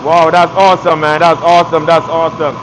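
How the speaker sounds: usual speaking voice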